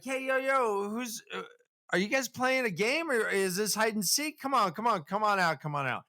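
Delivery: put-on high-pitched dad calling children out; mock searching father, high and casual; calling for his kids. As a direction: coax hiding kids in a high voice. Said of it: "he's calling for his kids".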